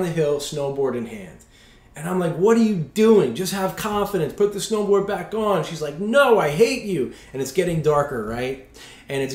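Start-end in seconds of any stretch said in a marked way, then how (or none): 2.4-5.6 higher-pitched, exasperated husband retelling; exasperated urging. Retold or imitated. Husband arguing with wife on the slope, exasperated. Acted quarrel on the mountain. 6.0-7.1 high, drawn-out retort; mock angry outburst. Wife snapping back at husband on the mountain, mock angry. Wife's angry reply in quarrel.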